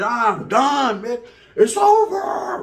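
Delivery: high, loud, slow drawn-out over; exasperated outburst. Retold or imitated. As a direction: blurt it out in despair. Giving up the fight.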